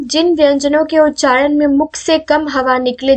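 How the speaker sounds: normal talking voice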